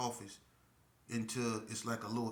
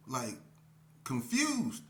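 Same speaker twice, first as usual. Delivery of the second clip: ordinary talk, nothing marked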